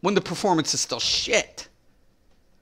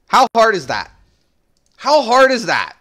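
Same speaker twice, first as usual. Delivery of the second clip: exasperated; 4 semitones higher, 6 dB louder than usual. Real, not acted. Ranting at developers.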